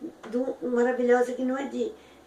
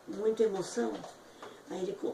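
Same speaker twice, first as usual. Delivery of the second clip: ordinary talk, nothing marked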